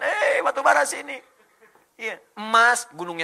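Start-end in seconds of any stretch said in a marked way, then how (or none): 0.0-1.1 high, loud mock shout; beckoning call. Coal beckoning from far off to searchers, high calling shout. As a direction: call out as if waving someone over from afar. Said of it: "kayak ngedadain".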